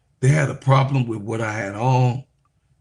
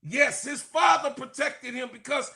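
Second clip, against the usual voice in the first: preaching shout; 11 semitones higher than usual. Real, not acted. Preacher defends Shedeur Sanders, shouting high with a laugh. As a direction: hit the climax, laughing and shouting.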